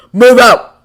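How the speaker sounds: loud commanding order; barked order. A narrator's reading. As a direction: bark the command. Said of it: "Fury ordered".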